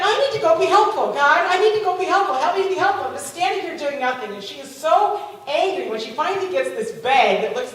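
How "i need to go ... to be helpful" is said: high, loud acted plea to God; exasperated prayer. Woman kept waiting for a ham, exasperated prayer. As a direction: chant it insistently, fighting impatience.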